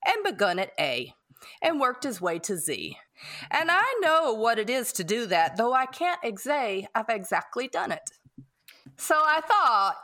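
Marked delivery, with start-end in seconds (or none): none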